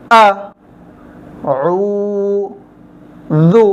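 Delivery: short আ, long level উ, then জু, widely spaced; broken-up syllables. Shown as a demonstration. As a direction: lead learners through আ, উ, জু, one sound at a time. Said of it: "আপনারা উচ্চারণ করেন আমার সঙ্গে".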